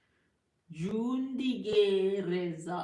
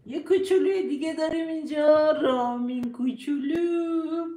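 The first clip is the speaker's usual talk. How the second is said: loud, fast, bright welcome that slows on the name; louder, cheerful calling out; excited greeting; 5 semitones higher, 8 dB louder than usual. Real, not acted. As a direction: announce the little one with delight. Greeting a little one on stream.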